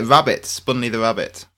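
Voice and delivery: usual speaking voice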